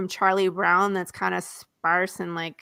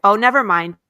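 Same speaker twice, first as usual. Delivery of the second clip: louder, quick never mind; brushing off; 10 dB louder than usual. Retold or imitated. Jude brushing off the bartender, louder. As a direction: wave the question away out loud.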